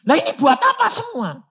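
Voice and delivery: loud, high, baffled cry; bewildered outcry. Retold or imitated. Preacher asking God what the money is for, loud and bewildered. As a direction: cry out in confusion.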